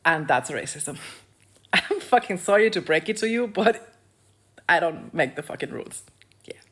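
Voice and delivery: normal talking voice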